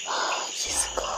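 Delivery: slow unvoiced whisper. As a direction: breathe it out in dismay. Night falls in mountain.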